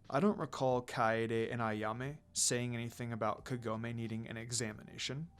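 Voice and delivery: a hint of a cool, wary lord; subdued low murmur, lightly voiced; low quiet Sesshomaru voice.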